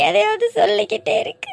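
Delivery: slow, high tearful plea, near breaking; crying to her friend she doesn't want the marriage. Acted. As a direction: beg through tears, slowly. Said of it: "அழுகாத, அழுகை நிறுத்து முதல்ல".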